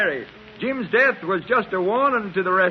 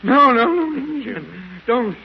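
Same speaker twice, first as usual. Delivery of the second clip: terrified pleading; 2 semitones higher, 4 dB louder than usual. Acted. Delirious beggar cries out at remembered attackers. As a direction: beg in panic not to be struck.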